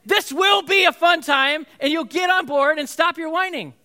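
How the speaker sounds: put-on a dad yelling at whining kids; frustrated shout. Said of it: "you're so frustrated".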